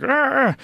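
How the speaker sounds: high, loud strained grunting; straining grunts. Retold or imitated. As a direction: grunt like you're straining against a hold. Imitating being stuck in a hold.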